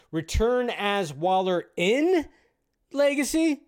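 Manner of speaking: stretched-out IN, then LEGACY lifted high as a question; slow, incredulous echo with a drawn-out "in"; questioning read. Acted. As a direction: read it as a puzzled question, leaning on "in". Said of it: "why did you say it like that".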